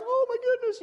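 high delighted gasp of words; overjoyed, voice way up; high thrilled exclamation; excited. Retold or imitated. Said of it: "I'm like".

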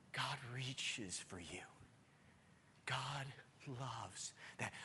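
tender hushed preaching. Acted. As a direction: lean in and almost whisper it.

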